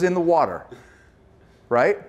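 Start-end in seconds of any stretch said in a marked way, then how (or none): none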